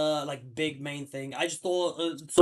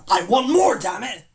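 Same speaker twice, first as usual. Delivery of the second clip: loud outburst; 5 semitones higher, 11 dB louder than usual. Real, not acted. Closing complaint about the film.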